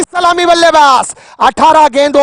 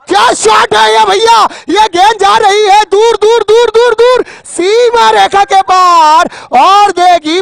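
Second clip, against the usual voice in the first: excited cricket commentary; 6 semitones higher than usual. Real, not acted. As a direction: yell it as the ball sails away. Ball flying for six.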